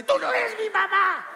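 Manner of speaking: tono agudo y lento de niño ofendido; niño caprichoso. Pretend. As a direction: reprocha como niño terco. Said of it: "la abuela dice". (vocecita aguda de niño caprichoso)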